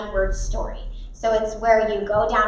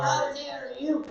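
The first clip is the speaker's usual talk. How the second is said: slow, hushed, play-offended; acted indignation; 9 dB quieter, slower than usual. Shown as a demonstration. Teacher leads word play, quiet mock indignation. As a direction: draw it out softly as if affronted.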